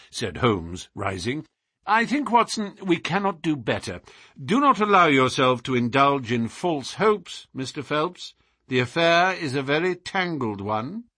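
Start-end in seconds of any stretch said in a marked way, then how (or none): 1.9-11.0 higher, firm parting counsel; lightly voiced detective cautioning a client; decisive detective. Detective warning his client against false hopes, decisive. Holmes takes leave of client.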